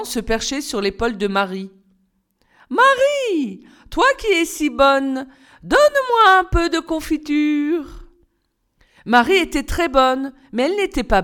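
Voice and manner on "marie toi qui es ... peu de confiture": high, slow, sweet-talking fairy; high pleading fairy voice. Acted. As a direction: coax her like a tiny old fairy. Fairy begs Marie for jam.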